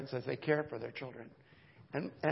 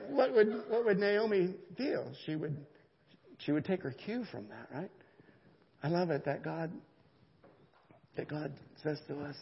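normal talking voice

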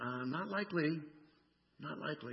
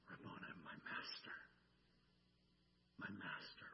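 1 awed whisper; 6 semitones lower, 16 dB quieter than usual. Acted. Mary recognizes Jesus.

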